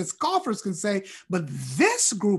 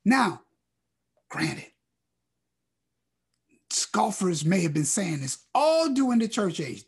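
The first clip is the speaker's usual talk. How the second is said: ordinary delivery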